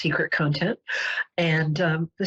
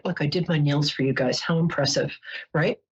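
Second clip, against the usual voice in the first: ordinary talk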